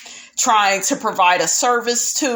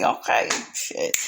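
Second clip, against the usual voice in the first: breathy, low whispered aside to herself; 7 dB quieter than usual. Real, not acted. Host whispering an aside while watching, hushed. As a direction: drop to a whisper, half cursing.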